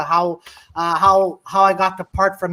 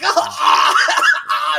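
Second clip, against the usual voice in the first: mock choking yell; 6 semitones higher, much slower than usual. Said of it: "Massimo's like".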